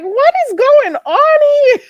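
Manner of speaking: high, loud laughing shriek of disbelief; mock shocked. Telling chat how she reacted, a laughing shocked squeal. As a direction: squeal it like you can't believe your ears. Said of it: "I was like".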